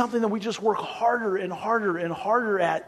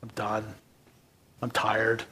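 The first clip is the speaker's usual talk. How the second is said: weary giving up; 9 semitones lower, 10 dB quieter than usual. Retold or imitated. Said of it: "You're like".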